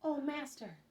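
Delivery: high, mildly excited cry; a storyteller's high exclamation. A narrator's reading. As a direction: exclaim it high, then let the pitch fall. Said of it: "They exclaim".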